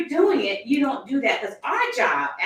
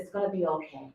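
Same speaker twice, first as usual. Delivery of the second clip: softer, lower comforting murmur; soothing reassurance; 4 semitones lower, 4 dB quieter than usual. Retold or imitated. Speaker calming a nervous passenger on a plane, soft and reassuring. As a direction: gently reassure a frightened stranger.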